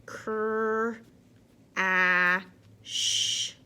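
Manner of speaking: Cr, a, sh split into separate sounds; sounding out words. Shown as a demonstration. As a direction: stretch each sound apart. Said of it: "blend this sound".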